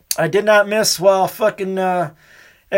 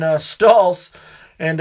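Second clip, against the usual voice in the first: usual speaking voice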